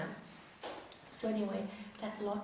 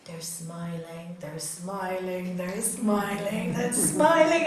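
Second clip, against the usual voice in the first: teasing singsong; 4 semitones lower, 5 dB louder, slower than usual. Retold or imitated. Wife teasing angry husband to make him smile, playful build. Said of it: "Sometimes I did that to my husband".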